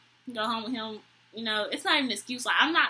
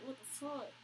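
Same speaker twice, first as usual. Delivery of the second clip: muttered disbelief; 3 semitones higher, 16 dB quieter than usual. Retold or imitated. Her reaction in her head.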